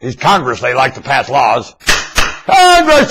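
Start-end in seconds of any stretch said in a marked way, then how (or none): 2.4-3.1 calling from far away. Acted. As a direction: call out as if to someone far off. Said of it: "I'm gonna shout over here".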